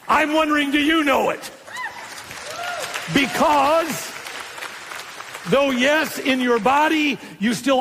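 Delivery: high, pressing question; preaching challenge. Real, not acted. Preacher asking congregation if they know it, challenging. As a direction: lift the whole question high at the listeners.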